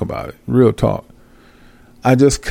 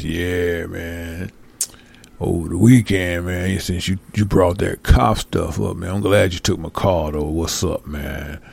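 mock caller; 6 semitones lower, 4 dB quieter than usual. Mocking a regular Blog Talk caller, low and lazy. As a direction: lower and quieter, droning like a bored caller. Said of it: "same people calling in, making up bull".

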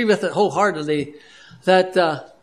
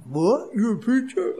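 lightly imitated surprised question, pitched up at the end; mock surprise; 4 semitones higher, 4 dB quieter than usual. Rough fellows learning the man is a preacher, mildly mimicked surprise. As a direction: retell their surprise, rising into the question.

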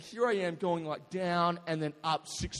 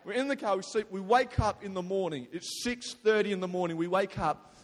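ordinary talk

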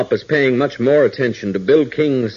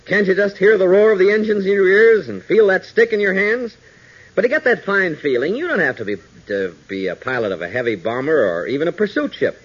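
bright, upbeat announcer pitch for private planes; radio ad read; 6 semitones higher than usual. Acted. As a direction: sell it like a vintage radio pitchman. Sponsor ad read before story.

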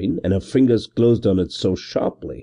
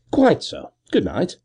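breezy dismissal; 9 semitones higher than usual. A narrator's reading. Gervase dismisses constable.

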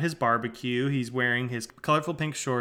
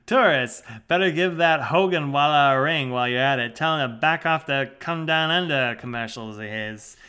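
louder put-on Aussie grumble; Australian accent; 5 dB louder than usual. Husband grumbling to his wife about tourists, Australian accent. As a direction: complain like a broad Australian bloke. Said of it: "In his Australian accent".

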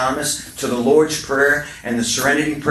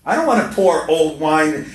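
usual speaking voice

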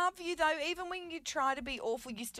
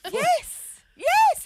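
high, loud squealed yes; shrieking triumphant yes; 14 dB louder than usual. Pretend.

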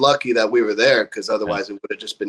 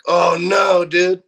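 high-pitched retold dismay; mock dismay; 6 semitones higher, 3 dB louder, much slower than usual. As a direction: react as if hearing awful news, lightly acted. Retold reaction to bad news.

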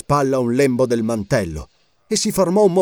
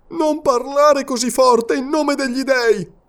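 high, slow, urgent hush; frightened warning; 13 semitones higher, slower than usual. A narrator's reading. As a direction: plead fearfully. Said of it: "esclamò impaurito".

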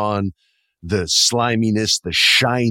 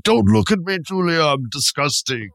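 high, hammed-up monster plea; campy corpse; 8 semitones higher than usual. Half corpse begging Julia, high and hammy. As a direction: plead like a melodramatic corpse. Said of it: "He's like".

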